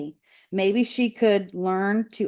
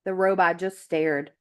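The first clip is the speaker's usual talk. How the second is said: ordinary delivery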